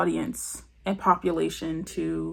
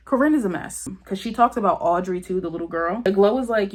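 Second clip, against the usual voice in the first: ordinary delivery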